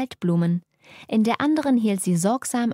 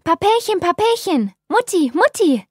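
much higher, louder sing-song call; calling from afar; 10 semitones higher, 7 dB louder than usual. Acted. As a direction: call to parents across the park. Daughter calling from the park.

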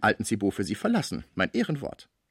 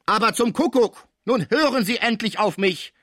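high, loud, exasperated cry; angry outburst; 13 semitones higher, 7 dB louder than usual. A narrator's reading. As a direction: snap in anger, demanding to be heard. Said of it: "der zornig geworden war". (zorniger Ausruf)

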